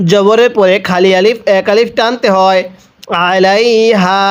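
ordinary talk, nothing marked

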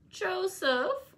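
whiny high call from the next room; peevish cry. A narrator's reading. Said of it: "cried a peevish, peevish voice".

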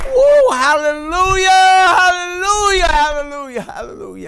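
high, loud hallelujahs fading to a hush; joy swelling then dying away; ecstatic laughing shout. Real, not acted. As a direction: cry out then sink back breathless. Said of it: "I wasn't expecting that".

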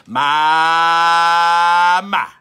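loud, drawn-out my my; loud, long-drawn church murmur; churchgoer marveling. Retold or imitated. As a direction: savor it like a moved elder. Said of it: "They might say".

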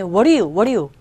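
"what do you" linked as "wuh-ri-you"; flapped t and d. Shown as a demonstration. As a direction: soften t and d in "what do you" to a flap. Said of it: "T음과 D음이 모음 사이이기".

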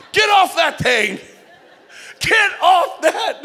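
high, slow shout. Acted. Speaker shouting at the audience to quit the ride, loud and slow. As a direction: yell it off the roller coaster.

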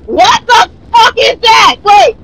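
startled shout. Real, not acted. Chased by monster in game.

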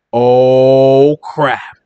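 low, comic dismay; sinking dread. Acted. Escapees spotting the chariots behind them, comic dread. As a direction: let it drop deep as trouble dawns.

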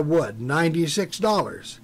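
plain reading voice

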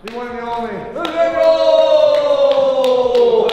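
hype announcer. Acted. Host welcoming a special guest to the house, hyped announcer shout. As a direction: build it up to a long shouted finish.

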